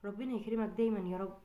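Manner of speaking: a tired wife greeting her husband gently; soft-spoken mother. Acted. Mother telling the father the girls studied for the tablet, softly.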